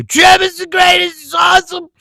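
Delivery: high, loud hammed-up fanboy shout; mocking fan voice. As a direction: parody the mindless fan cheering. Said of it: "So they go".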